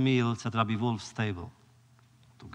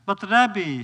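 higher, louder exasperated plea; 7 semitones higher, 7 dB louder than usual. Retold or imitated. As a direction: throw up your hands in protest. Coachman pleads, exasperated.

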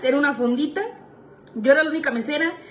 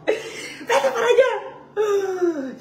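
mock yell; 8 semitones higher, much slower than usual. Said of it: "el manager me gritaba bien feo".

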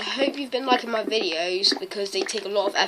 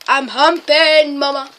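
slow loud silly chant; 5 semitones higher, 9 dB louder, slower than usual. Pretend. Silly performed shout.

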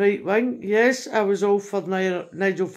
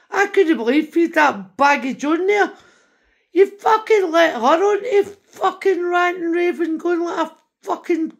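louder, higher, laughing in disbelief; incredulous rant; 8 semitones higher, 5 dB louder than usual. Retold or imitated. Telling Elon about the ranting woman, higher and louder with a scornful laugh. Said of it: "And I'm going".

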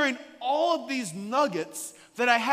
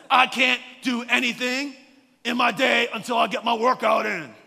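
loud, laughing shout of a tiny gym mom; mock soccer mom shout; 2 semitones higher, 7 dB louder than usual. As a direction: bellow it like a drill sergeant, half laughing. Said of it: "That's how she sounded".

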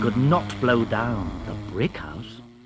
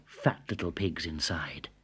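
suspenseful whisper; 6 semitones lower, 6 dB quieter than usual. A narrator's reading. Wolf smells the pigs.

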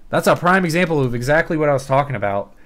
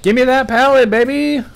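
excited yell; 11 semitones higher, 6 dB louder than usual. Real, not acted. Celebrates the pallet.

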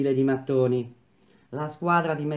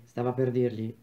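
ordinary delivery, nothing marked

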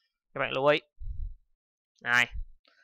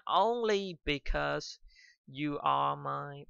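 "only because you are my" read very slowly, word by word; slow dictation; 4 dB quieter, much slower than usual. Shown as a demonstration. A teacher dictates the example sentence slowly. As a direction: spell the sentence out slowly for note-taking. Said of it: "ví dụ vậy".

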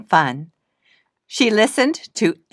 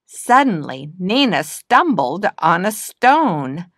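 ordinary delivery

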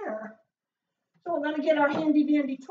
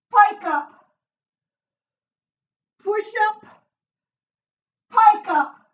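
loud, raised workout cues; called-out reps; 5 semitones higher, 9 dB louder, slower than usual. Real, not acted. Trainer counting moves for the class, loud call.

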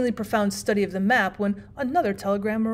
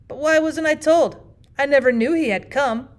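louder, raised-pitch exclamation; exclaiming; 4 semitones higher, 5 dB louder than usual. A narrator's reading. Host learns of the bishop's arrival, exclaiming. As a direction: protest at being kept uninformed. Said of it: "exclaimed Huddle".

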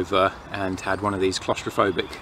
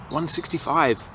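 higher, slow exclamation of disbelief; slow, pitched-up incredulity; incredulous; 6 semitones higher than usual. Real, not acted. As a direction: repeat the price in real disbelief. Incredulous at the petrol price.

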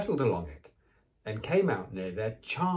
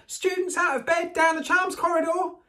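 high, loud alarm call; bellowing; 6 dB louder than usual. Acted. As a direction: raise the whole castle. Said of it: "Peeves bellowed".

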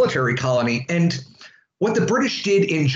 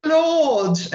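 high-pitched mock Downton Abbey British; posh British accent; much slower than usual. Teacher mocking Downton Abbey for the class, posh British accent. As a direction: put on a lofty, exaggerated British accent. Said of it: "the Downton Abbey and the".

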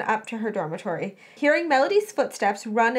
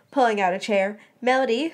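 ordinary delivery

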